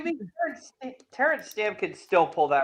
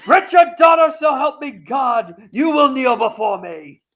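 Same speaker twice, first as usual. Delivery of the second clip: booming demon lord; 7 semitones higher, 7 dB louder than usual. Retold or imitated. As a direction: swear an oath like a tyrant. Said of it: "and he says".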